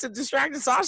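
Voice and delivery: ordinary talk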